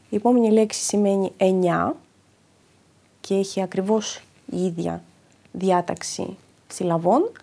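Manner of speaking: normal talking voice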